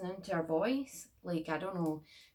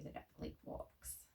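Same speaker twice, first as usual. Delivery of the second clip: whispered; 14 dB quieter than usual. Real, not acted.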